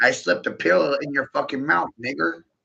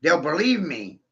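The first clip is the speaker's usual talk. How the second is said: ordinary talk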